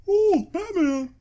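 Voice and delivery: high squeaky cartoon mouse; Mickey Mouse voice. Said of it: "Like Mickey Mouse".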